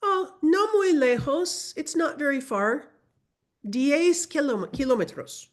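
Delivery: teacher voicing a Spanish local giving directions; local man answering. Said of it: "And he responds".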